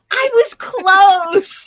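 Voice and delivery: high, loud, stretched-out exclamation; drawn-out protest. Real, not acted. Guesser reacting to the joke's punchline, drawn-out protest. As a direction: wail it in mock protest through laughter.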